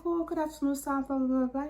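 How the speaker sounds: high, overly sweet politeness; butter him up on the phone; sweet polite voice. Retold or imitated. Polite phone greeting to boss.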